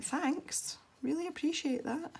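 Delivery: high sarcastic fake gratitude; sarcastic thanks. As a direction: thank them, dripping with sarcasm. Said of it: "I was like".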